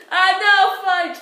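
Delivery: high, loud mock-swearing outburst; loud exasperation. Real, not acted. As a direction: cry out at your own mistake. Realises the slip mid-game.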